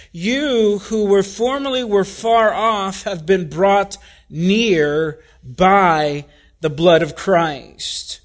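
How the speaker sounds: ordinary talk, nothing marked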